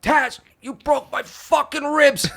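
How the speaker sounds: high, slow strained protest; shocked complaint. Retold or imitated. To the friend who hugged too hard, high winded protest. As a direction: squeeze it out, winded and stunned. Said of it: "I'm like".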